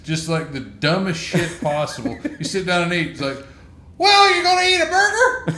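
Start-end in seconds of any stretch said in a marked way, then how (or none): 4.0-5.6 put-on very high, loud, laughing kid voice; mocking a clueless kid. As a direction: ham up the silly child asking the obvious. Mocking kid's dumb question.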